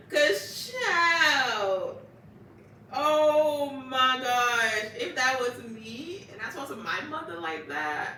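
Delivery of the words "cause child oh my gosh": loud, high, drawn-out exclamation; amused disbelief. Real, not acted. Reacting to a girl insulting her mother, real amused disbelief. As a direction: stretch it out, scandalized and cracking up.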